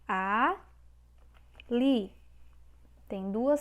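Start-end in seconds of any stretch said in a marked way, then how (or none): 0.1-2.1 "Ali" split into A, pause, LI; syllable by syllable. Shown as a demonstration. Teacher answering how to separate the word, syllable by syllable. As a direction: hold A, pause, then say LI. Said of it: "Como é que a gente separa?".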